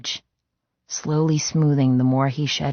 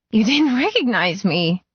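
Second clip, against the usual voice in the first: pitched up and breaking with hurt; hurt, tearful cry; 7 semitones higher than usual. Acted. Woman to her love, pitched up and tearful. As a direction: let the hurt crack the voice higher.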